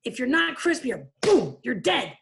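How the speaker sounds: high, fast, loud coach rant; fired-up coach rant. Retold or imitated. Coach chewing out indecisive players, ranting. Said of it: "He's like".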